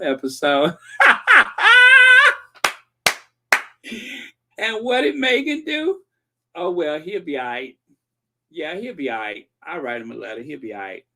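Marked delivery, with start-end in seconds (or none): 0.8-2.4 evil cackle. Acted. Gloating over failed scheme. 6.5-11.0 breezy shrug fading to softer; mock dismissive. Mocks Meghan's dismissive reply.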